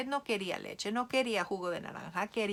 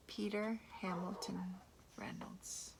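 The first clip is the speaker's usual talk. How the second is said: whispered; 10 dB quieter than usual. Acted. Whispered author name after reading.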